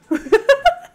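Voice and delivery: high delighted squealing laugh. Real, not acted. Owner laughs at her pet, high squeal.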